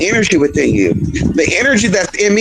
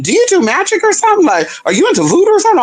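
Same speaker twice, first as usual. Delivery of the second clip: nervous client; 12 semitones higher, faster than usual. Retold or imitated. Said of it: "He was like".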